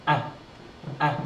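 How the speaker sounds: "a" as the brief vowel in mat; short a sound. Shown as a demonstration. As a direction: say each "a" short and separate. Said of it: "short sound".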